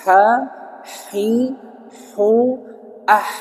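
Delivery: "ha, hi, hu, ah" with the other Arabic h, one by one; contrasting h-sound drill. Shown as a demonstration. Teacher contrasting two Arabic h letters, articulation drill. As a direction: set each "ha, hi, hu, ah" apart with a clear h.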